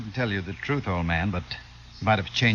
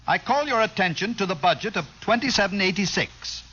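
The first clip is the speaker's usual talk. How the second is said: a lightly raised speechmaking voice; formal speech; 8 semitones higher than usual. Acted. Outgoing Kip giving his farewell speech to the crowd, formal speech. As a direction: address the assembled crowd with pomp.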